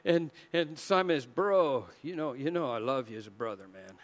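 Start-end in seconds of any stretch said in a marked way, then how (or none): 1.2-4.0 low, soft, quick brush-off; casual evasion. Acted. Simon dodging Jesus with brotherly love, casual and sheepish. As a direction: mumble it like an awkward buddy.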